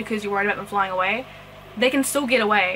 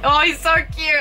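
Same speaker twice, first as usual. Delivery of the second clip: higher, louder adoring coo; cute gush; 6 semitones higher, 6 dB louder than usual. Real, not acted. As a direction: coo it at the little frog. Gushing over frog.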